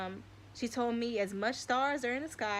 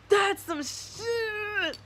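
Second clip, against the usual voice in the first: very slow, choked with tears; tearful awe; 11 semitones higher, 3 dB louder, much slower than usual. Real, not acted. Streamer moved to tears mutters in very slow tearful awe. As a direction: let it crack as if tearing up.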